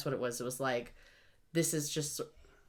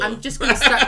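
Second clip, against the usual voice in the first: mock-eager shout; loudly eager; loud excited quote; 4 semitones higher, 13 dB louder than usual. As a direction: blurt it out, fired up to start over. Excited retelling of rereading.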